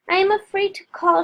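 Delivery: frightened gasp. A narrator's reading. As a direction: gasp it out in panic. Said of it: "she gasped".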